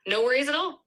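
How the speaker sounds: ordinary delivery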